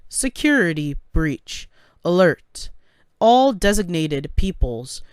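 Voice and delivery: loud, repeated automated alert; alarm announcement. Acted. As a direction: call it out like an emergency system announcement. Automated alarm announces security breach.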